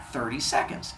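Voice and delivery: usual speaking voice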